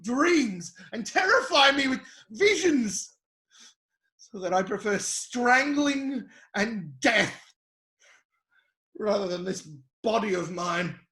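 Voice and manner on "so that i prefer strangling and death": ordinary talk, nothing marked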